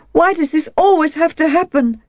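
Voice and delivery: put-on a hurt girl demanding an answer; demanding, near tears. A narrator's reading. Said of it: "she demanded".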